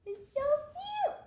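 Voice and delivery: high squeal of delight; so cute. Real, not acted. Child gushing over the baby bird in the book, squealing high.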